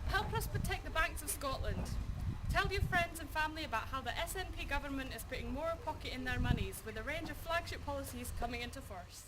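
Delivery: rousing party broadcast pitch; campaign ad read. Acted. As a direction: sell it like a TV campaign ad.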